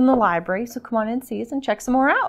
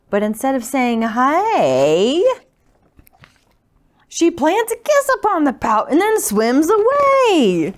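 lively, louder picture-book reading; story narration; 3 semitones higher, 5 dB louder than usual. A narrator's reading. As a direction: read it out rhythmically to little listeners. Reads picture book to children.

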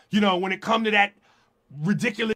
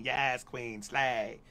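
mocking praise; 9 semitones lower, 11 dB quieter, much slower than usual. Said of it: "the hypocrisy".

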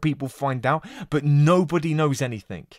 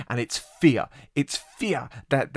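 usual speaking voice

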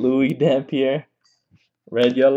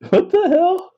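surprised. Real, not acted. Shocked at gold card pulled.

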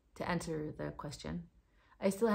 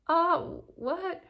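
oh, what, much higher and louder; retold surprise; 10 semitones higher, 6 dB louder than usual. Retelling her disbelief to viewers, high surprised oh what. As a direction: act out the disbelief again with a pitched-up question.

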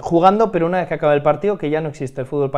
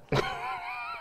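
high laugh; 12 dB quieter than usual. Real, not acted. Laugh during interview.

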